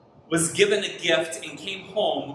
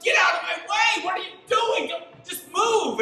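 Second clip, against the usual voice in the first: high, exasperated retold yell at the truck; frustrated outburst; 10 semitones higher than usual. Pastor reliving being blocked by the truck, exasperated high yell. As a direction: let the frustration burst out, pitched high. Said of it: "And I'm so frustrated".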